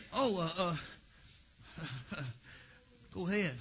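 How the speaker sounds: a lightly voiced defeated devil; mock devil giving way.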